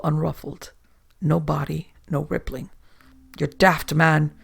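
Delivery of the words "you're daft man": plain reading voice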